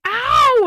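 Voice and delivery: high, loud "ow" imitating him; mock cry of sudden pain; retold yelp of pain. Boyfriend kicked in the night, mock pained yelp.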